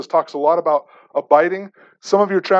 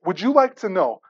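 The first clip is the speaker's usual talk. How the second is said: usual speaking voice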